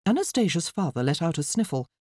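usual speaking voice

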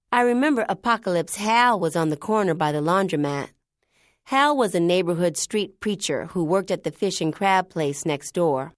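usual speaking voice

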